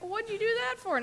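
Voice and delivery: high, startled question; man in the well, shocked by the rescuer jumping in; incredulous. Acted. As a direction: sound thrown by the jump.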